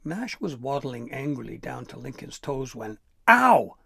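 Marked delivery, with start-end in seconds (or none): 3.3-3.8 very loud, high "ow"; sudden pained yelp. A narrator's reading. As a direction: yelp as something hits your head. Troll hit by a pine cone.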